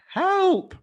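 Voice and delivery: high startled yelp; yelping for help. Retold or imitated. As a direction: cry out high in panic. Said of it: "as quick as you could say".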